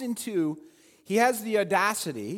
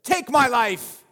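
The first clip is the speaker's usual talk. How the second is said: louder, slow command; imperative demand; 4 semitones higher, 6 dB louder, slower than usual. Retold or imitated. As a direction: demand it of God. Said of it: "Elijah says this in the imperative".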